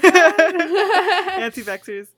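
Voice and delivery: slow drawn-out laughing word. Real, not acted. Joking with a laugh.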